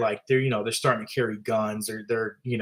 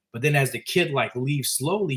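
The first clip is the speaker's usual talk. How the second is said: normal talking voice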